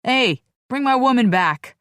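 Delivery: loud yell across the room. Acted. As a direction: shout it after her. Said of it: "he yells".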